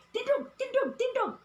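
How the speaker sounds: high singsong repeated call of "Tindog"; sing-song dialect command. Shown as a demonstration. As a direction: chant it like telling a child to stand up. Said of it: "in the dialect, we say".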